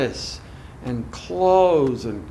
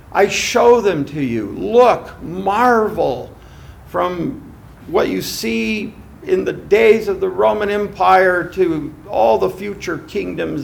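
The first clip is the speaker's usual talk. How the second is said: devil flattering; 3 semitones higher, 7 dB louder than usual. Acted. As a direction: swell with grand false praise. Preacher voicing the devil.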